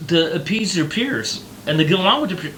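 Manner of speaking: usual speaking voice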